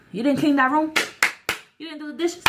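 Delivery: high-pitched demand, loud at first; mock scolding. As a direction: scold the kids in a retold telling-off. Re-enacting scolding younger siblings.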